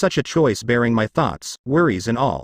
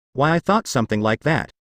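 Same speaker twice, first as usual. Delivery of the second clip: ordinary delivery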